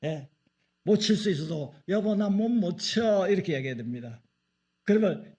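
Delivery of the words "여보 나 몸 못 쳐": slow, higher, coaxing plea; mock helpless wife. Wife asking husband for help, slow coaxing plea. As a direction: sweeten it to ask the husband.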